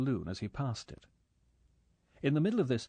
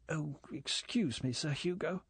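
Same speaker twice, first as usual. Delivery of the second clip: strangled voice; 5 semitones higher than usual. A narrator's reading. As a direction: squeeze the words out as if embarrassed. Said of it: "she said in a strangled voice".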